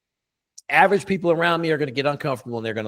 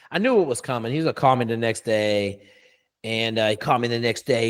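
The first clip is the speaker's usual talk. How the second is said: ordinary talk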